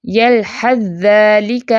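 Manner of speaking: very slow drawn recitation, ث merged into ذ; tajweed recitation. Shown as a demonstration. Teacher demonstrates idgham of tha into dhal, very slow. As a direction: recite یلحظ ذالک slowly with ث absorbed. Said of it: "ہم یلحظ ذالک نہیں پڑھیں گے".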